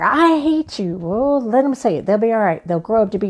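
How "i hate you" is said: put-on higher, bratty kid voice; kid's mock tantrum. Parent imitating a child: mock bratty shout. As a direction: play the angry child, lightly. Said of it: "they're gonna say".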